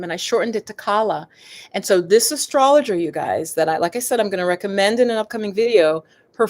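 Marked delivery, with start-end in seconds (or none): none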